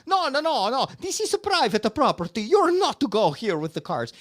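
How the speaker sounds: high, loud imitated scolding; mock angry neighbors. Neighbors scold drivers off their road, high and loud. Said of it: "fighting with the people".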